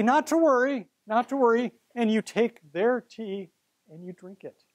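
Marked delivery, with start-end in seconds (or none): 0.0-1.7 mock reassurance. Reassuring nervous colleagues in story.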